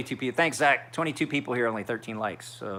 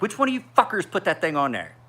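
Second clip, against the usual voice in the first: mock angry yell; 8 semitones higher, 6 dB louder than usual. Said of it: "your brother came out and was like".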